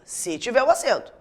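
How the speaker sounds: ordinary delivery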